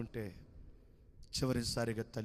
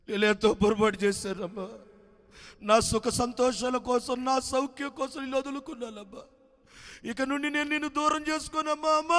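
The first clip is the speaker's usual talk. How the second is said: son's tearful plea; 11 semitones higher, 7 dB louder than usual. A narrator's reading. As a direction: beg forgiveness through sobs. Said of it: "అమ్మని పిలుస్తాడమ్మా".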